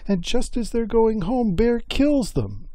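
high-pitched lament of an aggrieved mother; acted high wail over lost babies; wailing mother Salmon. A narrator's reading. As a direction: plead high, stretching the final words. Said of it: "Salmon was pretty sore with Bear".

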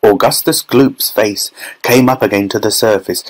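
ordinary delivery, nothing marked

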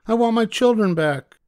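mother demanding at the witch's hut, very high and loud; calling out. Acted. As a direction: demand at the door. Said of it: "the mother called".

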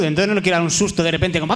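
normal talking voice

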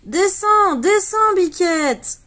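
high, loud call up a tree. Acted. Enzo calling Biquette down from the treetop, loud high call. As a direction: shout up to the goat through cupped hands. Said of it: "met ses mains en cornet et crie".

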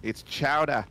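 mock Boston chowdah; Boston accent. Quoting the Simpsons chowder bit in a Boston accent. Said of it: "I remember that episode".

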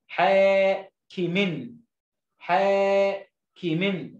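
very slow حاكم with a held aa, then كِمٍ; syllable sounding-out. Shown as a demonstration. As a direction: stretch حا long before completing حاكم. Teacher sounds out word by syllables. (نطق حاكم ببطء مع مد الألف)

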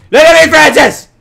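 very loud, high yell; demanding shout. Pretend. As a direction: yell for the cow's full attention. Demands the cow's attention.

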